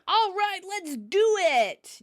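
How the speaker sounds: high, drawn-out rallying cry; mock enthusiasm. Retelling Chandler arriving with Joey, enthusiastic. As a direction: imitate someone rallying the group.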